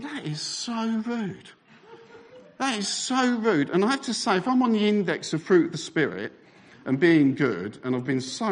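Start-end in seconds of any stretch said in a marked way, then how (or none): 0.0-3.7 high, slow, drawn-out outrage; acted indignation. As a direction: stretch out the offended disbelief. Indignant thought at stolen chips.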